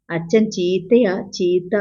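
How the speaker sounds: ordinary delivery, nothing marked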